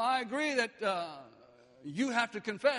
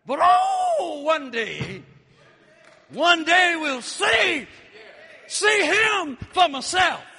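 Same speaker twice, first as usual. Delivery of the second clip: ecstatic preaching shout; 5 semitones higher, 10 dB louder, slower than usual. Acted.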